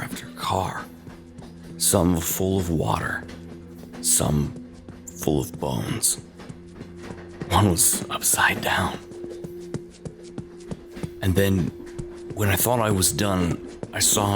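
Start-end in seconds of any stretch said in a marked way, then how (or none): none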